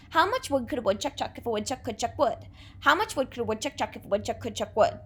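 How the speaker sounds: rattled off fast, every wood and chuck kept crisp; fast tongue twister. Shown as a demonstration. Teacher racing the tongue twister for the class to copy. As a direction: race through How much wood could a woodchuck chuck at speed. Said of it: "Your turn".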